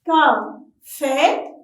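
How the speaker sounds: "café" split into ca, fé; two spaced teaching beats on "café"; syllable by syllable. Shown as a demonstration. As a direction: pause between the syllables of "café". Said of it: "Frappez-le en syllabe".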